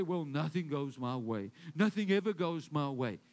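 low, deflated grumble; defeated complaint. Shown as a demonstration. Preacher mimicking a discouraged believer, low and defeated. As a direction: sigh it out like you've given up. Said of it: "When you say".

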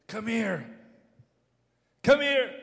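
high, loud summons; high, punchy preacher's call. Acted. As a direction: call them over with full force. Calls out as Jesus to the hurting.

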